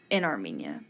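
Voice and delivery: normal talking voice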